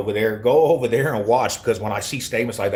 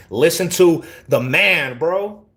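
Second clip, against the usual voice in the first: slow, high, louder telling-off; emphatic; 8 semitones higher, 5 dB louder, slower than usual. Real, not acted. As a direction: lay it down slowly and insistently, pitched up. Streamer tells off a chat commenter.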